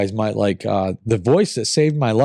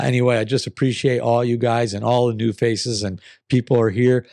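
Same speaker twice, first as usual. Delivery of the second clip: usual speaking voice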